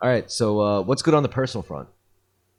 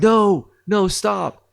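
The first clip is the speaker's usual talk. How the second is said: high, raised cry of alarm; urgent cry; 12 semitones higher, 7 dB louder, much slower than usual. Retold or imitated. Retelling the hero stopping his son at the dangerous spot, urgent cry. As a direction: call out to halt him.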